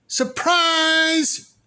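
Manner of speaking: spring the surprise on her; shouted surprise. A narrator's reading. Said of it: "everyone shouted".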